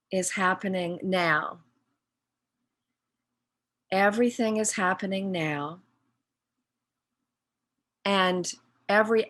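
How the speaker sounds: slow, measured meditation guide with long pauses; guided meditation. Real, not acted. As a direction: guide them inward phrase by phrase, leaving long silences.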